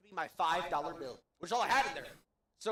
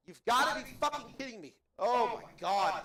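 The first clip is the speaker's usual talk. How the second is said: exasperated; 4 semitones higher, 7 dB louder than usual. Real, not acted. Exasperated outburst.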